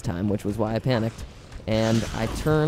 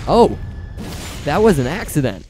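excited surprise; 7 semitones higher than usual. Real, not acted. Gamer after a lucky kill, excited yell. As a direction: burst out in delighted disbelief.

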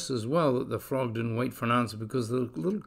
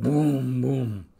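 booming; 4 dB louder than usual. A narrator's reading. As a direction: boom it like a drum. Booming noise sound effect.

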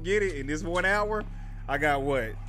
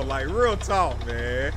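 normal talking voice

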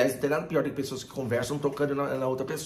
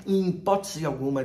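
ordinary talk, nothing marked

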